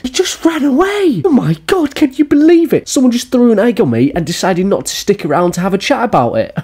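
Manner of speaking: very high squealing mimicry; mock outrage. Reacting as the streamer someone threw an egg on, mock outrage.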